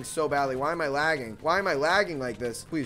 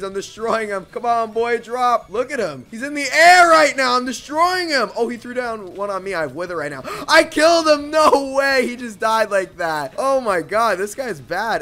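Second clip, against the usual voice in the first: hyped shouting, much higher and louder; excited yelling; 8 semitones higher, 11 dB louder than usual. Real, not acted. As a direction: yell it out mid-fight. PvP fight win, shouting.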